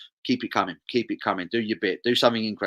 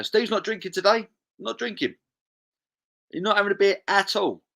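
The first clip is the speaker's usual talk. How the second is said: mock surprise; 10 semitones higher than usual. Mock surprise reading chat.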